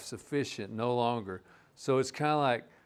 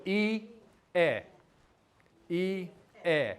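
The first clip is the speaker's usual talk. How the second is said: I, eh drawn apart into two slow syllables; slow teaching demo; 4 semitones higher, slower than usual. Shown as a demonstration. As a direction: say I, eh slowly, syllable by syllable. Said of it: "if I say it slow".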